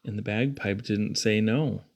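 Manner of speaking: ordinary delivery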